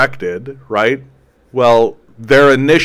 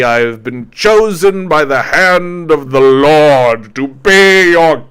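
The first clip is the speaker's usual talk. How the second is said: slow, loud, grand royal proclamation; pompous king; 3 semitones higher, 6 dB louder, much slower than usual. Retold or imitated. King proclaims divine right to his subjects, slow pompous loud. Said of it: "eventually it's like".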